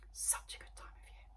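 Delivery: hushed whispered echo. Real, not acted. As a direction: breathe it out to yourself. Whispered repeat.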